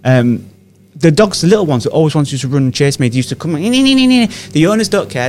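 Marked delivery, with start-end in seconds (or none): 3.5-4.3 yapping dog imitation. Small dogs yapping imitated.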